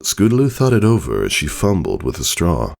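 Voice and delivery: plain reading voice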